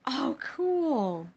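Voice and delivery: high, loud "oh, cool"; genuinely thrilled squeal of approval; delighted. Real, not acted. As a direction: react with loud, high excitement. Pleased reaction to answer.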